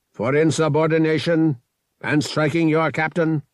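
a hint of cold official sentencing; formal announcement. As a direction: pronounce charges like a judge. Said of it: "he announced".

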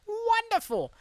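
Wonderful in a very high, falling squeak; squeaky mock wonderful.

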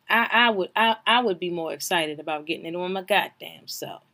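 usual speaking voice

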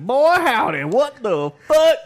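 high, loud Southern yelp; Southern grandma. Retold or imitated. Imitating grandma after a sip of moonshine, loud high Southern yelp. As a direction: shriek like a grandma hit by moonshine. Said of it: "was like".

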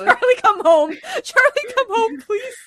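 shrill high cry for help; panicked damsel. Pretend. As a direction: scream for Charlie in mock panic. Mock damsel calling for Charlie.

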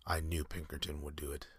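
plain reading voice

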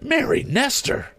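high cry of astonished recognition; surprised exclamation. A narrator's reading. Tom recognizes the young lady on the roof, astonished. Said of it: "he exclaimed".

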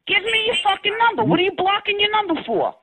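high, heated demand for the number; angry demand. Real, not acted. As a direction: snap at him to hand over his number.

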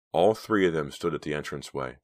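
ordinary delivery, nothing marked

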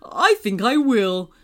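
deep, kind moon; gentle old moon agreeing. Acted. Moon speaks.

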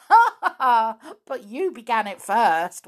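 loud ha ha ha, teasing; loud chuckling. Acted. Flip teasing Binkle, chuckling loudly. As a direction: laugh right at him. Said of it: "chuckling loudly".

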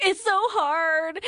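slow, high whine; whiny girlfriend. Retold or imitated. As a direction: drag it out in a whine. Mocking Victoria's whining.

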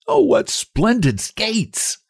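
delighted. A narrator's reading. Child thrilled by Christmas gift.

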